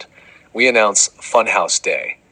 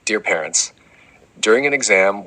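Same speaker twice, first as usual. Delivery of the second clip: ordinary talk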